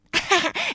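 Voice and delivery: high gleeful giggle; happy laugh. A narrator's reading. Friends in a snowball fight, gleeful giggle. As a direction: giggle in the fun. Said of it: "尽情地笑着".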